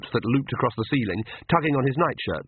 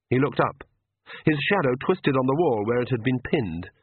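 plain reading voice

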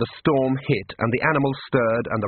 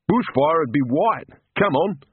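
slow, higher scoffing voice; scoffing townsman; 4 semitones higher, slower than usual. Acted. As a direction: brush the idea off. Spectator dismisses the bushfire idea.

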